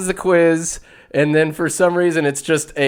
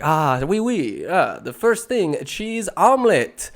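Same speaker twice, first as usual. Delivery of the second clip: French language tape; 6 semitones higher than usual. Retold or imitated. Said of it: "and it's like".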